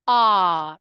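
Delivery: loud, held "R" as "aah"; long AH sound. Shown as a demonstration. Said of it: "which is the R sound".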